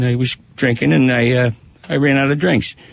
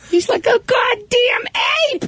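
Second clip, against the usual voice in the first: put-on high, shouted old lady voice. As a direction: squawk it like the outraged coworker. Jenny voiced.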